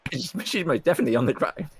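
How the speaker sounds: laughing it out, quick. Real, not acted. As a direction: giggle through the words. Laughing about fallen player.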